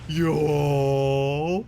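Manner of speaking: long-drawn whale moan rising at the end; drawn-out Southern whale song; Southern whale call. Pretend. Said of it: "Southern whale calls".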